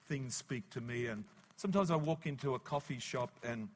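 ordinary delivery

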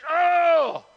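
long, high groaning oh; drawn-out mock birthing wail; imitated labour cry. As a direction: mimic a woman hit by a contraction.

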